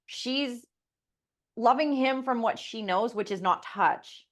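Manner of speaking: normal talking voice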